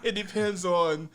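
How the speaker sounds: slow, amused laugh through the line. Real, not acted. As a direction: chuckle it out. Laughing through his point.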